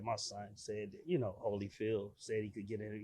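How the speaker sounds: very soft and low; deferential quoted appeal. Retold or imitated. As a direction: explain quietly and respectfully to a guard. Quotes his polite appeal to guard.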